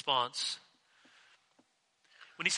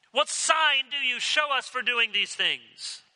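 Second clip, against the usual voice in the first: higher, slow, demanding the sign; indignant challenge; 8 semitones higher, 3 dB louder, slower than usual. Retold or imitated. Temple authorities demanding a sign from Jesus, indignant. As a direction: press him for proof with indignation.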